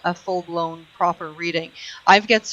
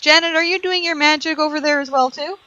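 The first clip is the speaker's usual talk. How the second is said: higher-pitched question to a colleague; calling over; 7 semitones higher than usual. Real, not acted. Host turns to the astrologer, calling over.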